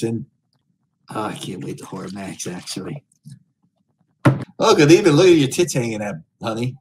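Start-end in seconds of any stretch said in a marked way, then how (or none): 4.6-6.8 loud, high leering lounge-lizard greeting; sleazy greeter. Acted. Streamer greets Omegle strangers as a leering creep, loud and high. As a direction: belt it out like a creepy host hitting on strangers.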